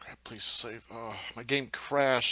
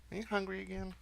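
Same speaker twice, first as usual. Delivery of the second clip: pet talk; 10 semitones higher, 5 dB quieter, faster than usual. Real, not acted. Talking to cat Gabby.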